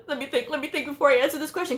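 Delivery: high, rapid self-talk; acted fluster. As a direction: race through it as if stalling in panic. Retold flustered thinking during exam.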